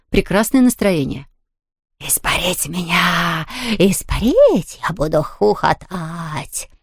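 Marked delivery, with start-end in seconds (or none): 2.1-6.7 slow, hollow ghostly voice; echoing ghost voice. A narrator's reading. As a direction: boom it like a playful ghost. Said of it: "крикнул Хуга гулким голосом". (гулкий голос привидения)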